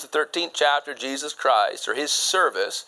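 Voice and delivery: ordinary talk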